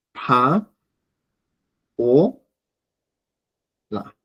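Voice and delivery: "pa, o, la" with long pauses between; slow, isolated syllables, "pa, o, la". Shown as a demonstration. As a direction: break "pa, o, la" into separate syllables. Spells the name syllable by syllable.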